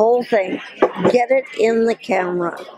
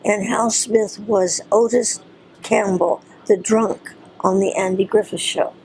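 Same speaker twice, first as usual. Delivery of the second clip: ordinary delivery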